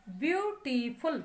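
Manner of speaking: Beautiful drawn out slowly in separate syllables. Shown as a demonstration. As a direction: break beautiful into three slow beats, ब्यू-टी-फुल. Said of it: "उस sound पर हम stress देते हैं".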